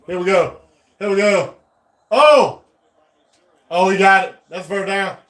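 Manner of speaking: high, rising game-winning elation; excited celebration. Real, not acted. Fan watching his team clinch the game, excited celebration. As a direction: build from hopeful to jubilant.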